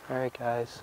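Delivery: casual, offhand hello; nonchalant greeting. Retold or imitated. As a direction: say it like you don't care. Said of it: "kinda just nonchalant, like".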